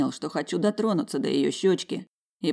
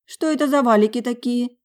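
high, louder puzzled question; surprised; 8 semitones higher, 5 dB louder than usual. Acted. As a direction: ask in surprise. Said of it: "удивилась она".

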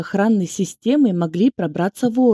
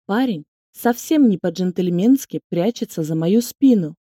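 ordinary delivery

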